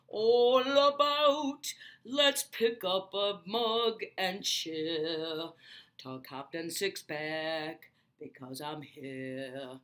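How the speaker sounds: slow and loud, in a changed voice; grand toast. Acted. Character rallying viewers to a toast in a slow, loud bellow. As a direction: raise a mug and bellow it slowly.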